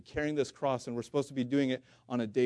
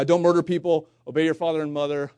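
loud and higher, banging out each commandment; emphatic command; 3 semitones higher, 8 dB louder than usual. Retold or imitated. Preacher voicing Jesus listing commandments to the young man, loud and emphatic. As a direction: proclaim it like a booming order.

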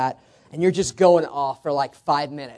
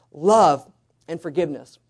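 ordinary delivery

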